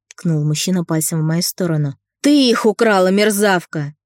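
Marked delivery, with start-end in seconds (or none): 2.2-3.9 higher, louder enraged accusation; furious shout. A narrator's reading. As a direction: spit it at the thief. Man accusing maid of theft.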